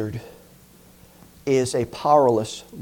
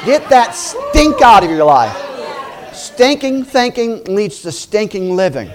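loud, higher shout through a laugh; preaching shout; 5 semitones higher, 4 dB louder than usual. Real, not acted. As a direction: blast the stink out of them.

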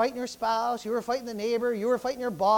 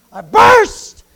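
sudden high, very loud shout on BURST; explosive burst; 10 semitones higher, 19 dB louder than usual. Shown as a demonstration. Preacher showing congregation what bursting means, explosive shout. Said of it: "that word burst doesn't, it doesn't indicate".

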